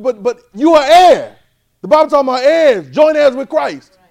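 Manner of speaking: high, loud, slow preaching peak; preaching at full force. Real, not acted. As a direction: lean in and proclaim it slowly. Preacher building to climax.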